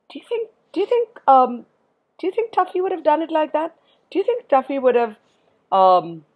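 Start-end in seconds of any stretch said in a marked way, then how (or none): none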